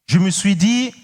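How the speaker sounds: ordinary delivery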